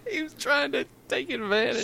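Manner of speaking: high squealing laugh into the line; giggling accusation; laughing tease. Real, not acted. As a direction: tease while cracking up.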